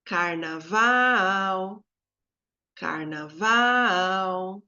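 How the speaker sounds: CARNAVAL called slowly, final VAL held long; drawn-out musical "Carnaval", weight on VAL; calling the word. Shown as a demonstration. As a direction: call out "Carnaval", stretching the VAL.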